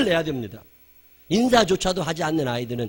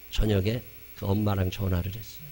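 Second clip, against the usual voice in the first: ordinary delivery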